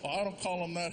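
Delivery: normal talking voice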